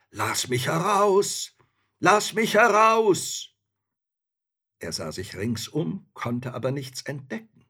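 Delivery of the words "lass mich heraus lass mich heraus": high, loud, drawn-out plea from below; muffled calling voice. A narrator's reading. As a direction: call out slowly and loudly, as if trapped underground. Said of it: "mit so einem recht dumpfen Ton rief".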